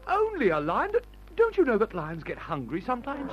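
high, incredulous panic; alarmed disbelief. Acted. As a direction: stammer in nervous protest. Alarmed at companion's calm about lion.

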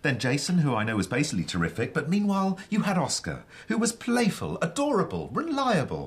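high, emphatic listing; insistent, rising enthusiasm; animated persuading. Acted. Animated argument listing her partners.

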